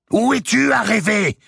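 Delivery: sudden high, loud yell; abrupt cry. A narrator's reading. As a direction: startle her with a sharp, high shout. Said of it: "lui cria brusquement".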